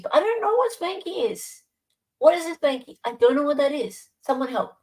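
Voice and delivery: high, exaggerated confusion; mock bewildered. Real, not acted. Baffled by chat asking about a Spanky, high-pitched. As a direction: throw up your hands, squeaky and lost.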